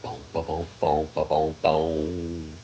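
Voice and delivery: low beat noises. Pretend. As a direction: hum a deep bass beat under your breath. Imitated beat sounds.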